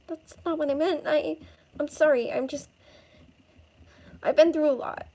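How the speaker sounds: high, distressed, halting apology; upset apology. Acted. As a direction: plead to be understood, pitched high. Girl apologizes, upset, to rescuer.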